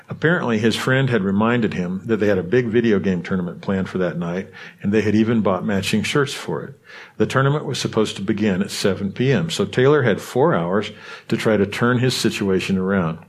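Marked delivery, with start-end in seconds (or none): none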